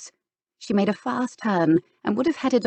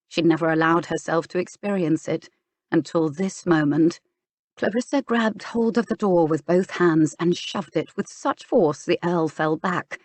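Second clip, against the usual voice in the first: ordinary delivery, nothing marked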